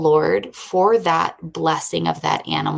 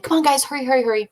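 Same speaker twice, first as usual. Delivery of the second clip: higher-pitched excited urging; excited hurrying; 8 semitones higher, much faster than usual. Retold or imitated. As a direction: hurry the kids along excitedly. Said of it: "We're so excited".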